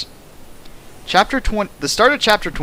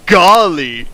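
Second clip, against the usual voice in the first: hammed-up golly; 3 semitones higher, 8 dB louder than usual. Hammed-up exclamation in reading.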